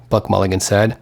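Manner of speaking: ordinary delivery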